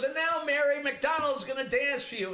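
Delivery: hammed-up showman announcing the act; stage announcer.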